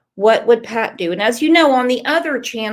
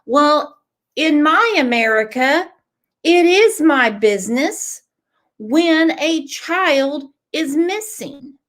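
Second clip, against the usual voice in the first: emphatic, raised pitch, deliberate pauses; indignant declaration; 4 semitones higher than usual. Real, not acted. Host answering bullies about a missing child, indignant declaration. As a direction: drive each phrase home to the critics.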